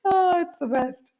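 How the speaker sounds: high, helpless laughter through the words; laughing hard. Real, not acted. Teacher telling class about cold sea swims, laughing hard. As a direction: laugh it out, can barely speak.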